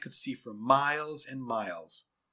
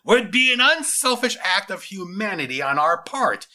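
loud, high, overriding retort; forceful interruption; 7 semitones higher, 11 dB louder than usual. Acted. General cutting off his lieutenant's objection, forceful. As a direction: cut in and drown out the objection. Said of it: "interrupted Solomon".

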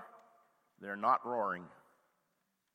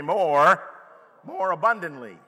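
loud rising cry of more; shouted call; 10 dB louder, slower than usual. Acted. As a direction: belt it out to the crowd. Preacher leads congregation call.

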